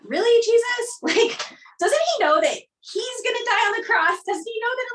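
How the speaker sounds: exasperated, half-laughing protest at Jesus; incredulous. Retold or imitated. Guest voicing her own doubt to the host, high and incredulous. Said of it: "sometimes I'm like".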